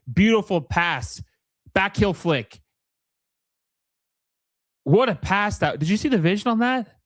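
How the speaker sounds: high, thrilled reaction to a great play; excited commentary. Real, not acted. Commentator marvelling at a beautiful flick and save, excited.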